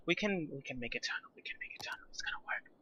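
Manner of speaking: hushed conspiratorial whisper. Pretend. Streamer whispering a tunnel plan to herself, hushed.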